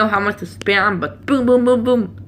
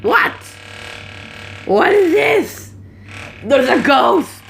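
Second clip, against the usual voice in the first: high, slow voice for his dog; voicing a spooked pet dog; 8 semitones higher, slower than usual. Telling viewers what his dog thinks, in a high slow dog voice. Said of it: "Even my dog here is like".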